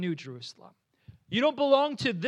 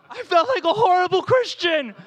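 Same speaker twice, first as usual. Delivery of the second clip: loud, high comic shout; exasperated outburst; 9 semitones higher, 10 dB louder than usual. Acted. Preacher confessing to the congregation about the stranger, exasperated shout. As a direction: cry it out in mock exasperation.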